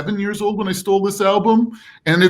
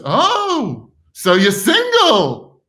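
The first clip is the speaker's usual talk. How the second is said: put-on old lady voice; 7 semitones higher, 4 dB louder, much slower than usual. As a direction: draw it out like a hopeful old lady. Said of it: "She goes".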